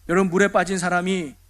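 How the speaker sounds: ordinary delivery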